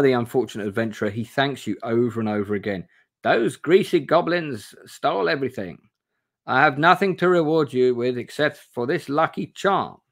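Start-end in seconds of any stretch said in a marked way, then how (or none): 3.2-10.0 put-on higher, lightly accented grateful stranger; a hint of the foreign accent, thankful; Victor's accent. Victor thanks his rescuer in a lightly put-on accent. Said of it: "Victor says".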